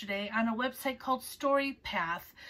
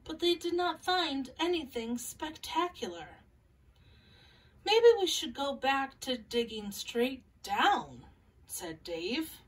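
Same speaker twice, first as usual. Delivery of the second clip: gentle picture-book read-aloud with small character voices; calm storybook reading for young listeners; reading to children. Acted.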